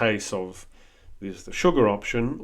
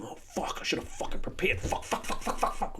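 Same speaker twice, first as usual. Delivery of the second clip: whispered, softer self-cursing in a panic; panicked whisper; 9 semitones higher, 6 dB quieter than usual. Retold or imitated. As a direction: hiss the curses under your breath. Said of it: "stuck with that voice going".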